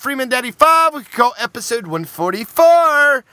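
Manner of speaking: ordinary talk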